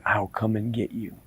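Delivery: low, hushed menace; softly sinister; quiet threat. Acted. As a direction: drop low and soft to threaten. Hollow-eyed man threatens the boy.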